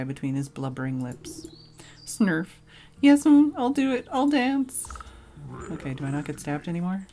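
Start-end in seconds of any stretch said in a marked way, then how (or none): 2.0-2.5 wet snot snort. Acted. Bandit snorts while eating. 3.0-4.9 high, loud weepy thief, sniffling between words; a big rogue crying his heart out; blubbering bandit. Acted. Bandit agrees tearfully.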